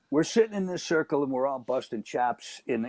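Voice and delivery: normal talking voice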